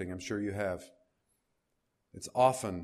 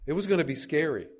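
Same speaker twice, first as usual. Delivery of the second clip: urgent; 6 semitones higher, 5 dB louder, faster than usual. Acted. Building to a point about fear.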